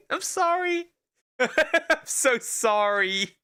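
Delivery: helpless high-pitched laughter breaking up the words; laughing apology. Real, not acted. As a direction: apologise through a real giggling fit, voice leaping up. Cracks up at own accent bit.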